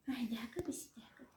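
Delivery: hushed unvoiced whisper. Real, not acted. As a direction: whisper so the kitten stays. Whisper heard.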